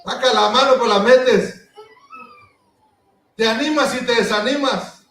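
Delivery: loud, teasing preacher's rebuke; preaching at full force. Acted. Pastor teasing lukewarm church members, loud playful rebuke. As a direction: chide the half-hearted with a laugh. Scolding half-hearted believers.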